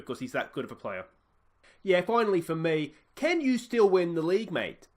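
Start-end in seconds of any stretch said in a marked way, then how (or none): 1.8-4.9 higher, louder Australian reporter's question; Australian interviewer prodding the manager, raised pitch; Australian journalist. Pretend. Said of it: "Chaz Hogan, Australian journalist".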